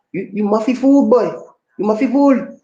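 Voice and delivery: high, loud mock Gullah scolding; Gullah accent. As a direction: imitate a Gullah speaker calling someone a fool. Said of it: "And it comes out like".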